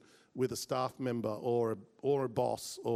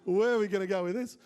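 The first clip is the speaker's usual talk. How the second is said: half-laughing mock panic, pitched up; freaking out inside; 6 semitones higher than usual. Speaker's inner panic at a risky request, freaking out. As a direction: groan it high in comic dismay. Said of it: "I'm like, I'm freaking out".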